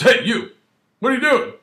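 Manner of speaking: sharp, loud suspicious officer calling out; barking cop challenge. Retold or imitated. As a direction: bark it out like a cop stopping someone. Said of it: "Well, he doesn't really say that. He goes".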